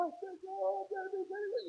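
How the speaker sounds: high, soft imitation of weeping prayer; mock tearful plea. Preacher imitating a weeping brother in church, tearful high plea. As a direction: imitate a brother's thin, tearful cry for mercy. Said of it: "El hermano de la paz llorando".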